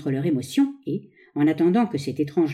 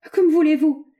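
high, loud, alarmed exclamation; distressed cry; 10 semitones higher, 5 dB louder than usual. A narrator's reading. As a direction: cry out in helpless protest. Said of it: "cria le malheureux savant".

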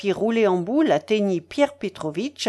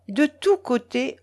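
ordinary delivery, nothing marked

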